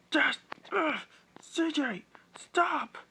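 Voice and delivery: very high, loud panicked cry; frightened pleading. Acted. Alexis begging CJ to stop shooting, high panicked cry. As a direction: beg him to stop in terror.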